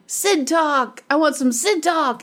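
imitating college friend. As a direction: lightly ham up the crazy guitar player. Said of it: "That's exactly how he talks".

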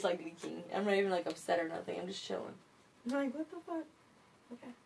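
normal talking voice

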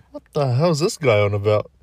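voicing the critics who cringe at him; mocking critic. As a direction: sneer it like a doubter in the crowd.

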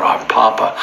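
normal talking voice

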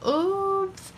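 gasping, high-pitched oops; mock gasp. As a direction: play it as a hammy gasp of shock. Gasp at own mistake.

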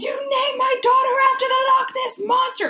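very high, loud mock shriek; hammed-up outraged yell. Host re-enacting a Twilight scene, hammed-up outraged shriek. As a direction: screech it as an over-the-top movie quote. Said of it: "and she goes".

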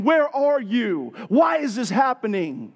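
high, slow cry flung upward; yelling at God. Retold or imitated. Doubter shouting at God under the fig tree, anguished cry. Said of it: "yelling at God and saying".

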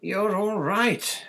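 high, loud, drawn-out relieved wail; distracted relief. A narrator's reading. Mother seeing her family safe, distracted relief. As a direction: stretch it out high and overwrought. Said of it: "muttered distractedly".

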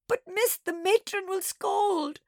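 put-on high, slow, loud childish wail; drawn-out, melodramatic tearful plea. A narrator's reading. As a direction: whine and wail pleadingly. Child keeps wailing to teacher.

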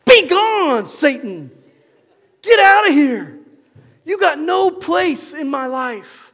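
full-force high shout; loud, furious command to get out; not nice at all. Shown as a demonstration. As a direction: banish the enemy with a huge yell. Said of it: "You don't have to be nice to Satan".

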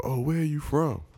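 a hint of a street seller fishing; lightly mocked hawker. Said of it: "And they go".

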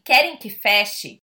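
very slow and spelled out; slow deliberate. Retold or imitated. Brazilian diner slowly repeating what she understood. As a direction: say each word slowly as you first heard it. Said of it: "E eu entendi assim".

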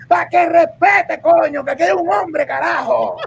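hammed-up macho shout; mock shout. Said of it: "Dice el tipo".

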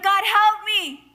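high, loud, drawn-out cry for help; acted desperate prayer; pleading. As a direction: plead upward, slowly and loudly. Acted plea to God for help.

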